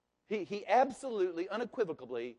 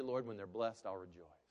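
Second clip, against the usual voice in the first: ordinary delivery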